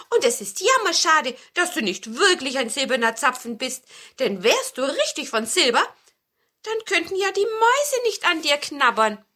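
lively storybook regret; regretful dwarf. A narrator's reading. Dwarf regrets cone is not silver. (leicht gespielter, bedauernder Zwerg)

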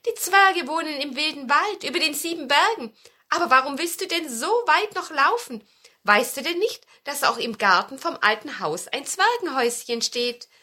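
lebhafte Hexe mit höherer, lauter Stimme; erklär es dem Besen eifrig als Hexe; hilfsbereite Hexe. A narrator's reading. Spielt die Hexe im Märchen. (energische Märchenhexe, auffordernd)

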